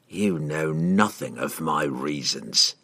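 low, soft and slow; quiet villain closing the subject; guarded assassin. A narrator's reading. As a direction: give nothing away, low and deliberate. Assassin coldly rebuffs the wizard.

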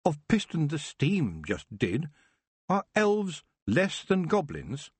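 slow, pointed questions; Tiffany shaming the elf; challenging. Acted. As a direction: press the point home.

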